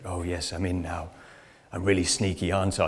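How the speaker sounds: quiet, smug, lightly mocking; smug sneaky whisper-ish. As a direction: mimic a cheesy actress pleased with herself. Mocking woman in training video.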